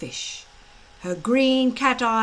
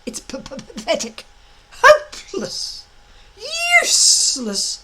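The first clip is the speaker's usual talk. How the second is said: stuttering taunts; 8 semitones higher, 6 dB louder than usual. A narrator's reading. Dragon taunting Fireworm.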